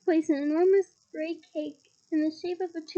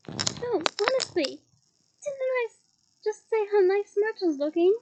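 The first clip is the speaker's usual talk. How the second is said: higher, anxious insisting; 5 semitones higher, 7 dB louder than usual. Acted. Hermione hastily covers for herself to Myrtle, pitched high. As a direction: protest a little too eagerly. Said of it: "said Hermione".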